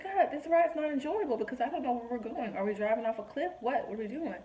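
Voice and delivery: high-pitched mock panic; anxious protest. Believer objecting to God about the ride, high mock anxiety. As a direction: complain upward like a worried child. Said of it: "same kind of anxiousness".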